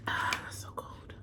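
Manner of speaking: breathy whisper, slow; soft slow whisper at the cold drink. Real, not acted. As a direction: whisper it to yourself as the cold hits. Reacting to cold water.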